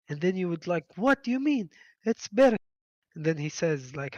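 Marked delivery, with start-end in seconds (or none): 1.0-2.6 high, loud disbelief; what do you mean, louder; incredulous. Retold or imitated. Mock incredulous reply.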